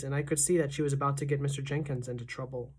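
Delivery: ordinary delivery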